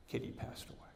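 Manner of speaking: breathed out, almost silent; gentle hush. Acted. Butler gently breaks the cat's death to his boss, hushed. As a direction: let the sad news slip out under the breath.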